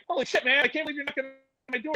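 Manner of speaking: high, fast, breathless awe; starstruck disbelief. Retold or imitated. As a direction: gush in amazement. Starstruck at star at door.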